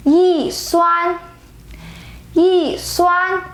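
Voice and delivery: "Yi" falls from high, then "suan" stays high and level; changed fourth tone. Shown as a demonstration. Teacher showing yi before first tone: falling "Yi", high level "suan". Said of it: "We say".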